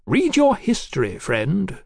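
high start, falling on "friend"; retort. A narrator's reading. Marquis answering Mallory's doubts, high-pitched retort. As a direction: fire it back as a lofty comeback. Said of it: "the Marquis retorted".

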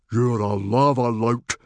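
loud, outraged roar; roared accusation. A narrator's reading. Stoic confronts the stranger, loud roar. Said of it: "roared Stoic".

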